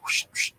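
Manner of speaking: soft, breathy whoosh noise; whispered swishing sound effect; imitated whooshing. Man showing how he did the job, whooshing.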